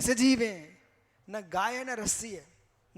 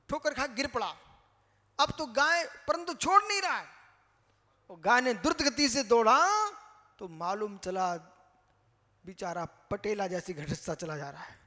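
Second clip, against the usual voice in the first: ordinary delivery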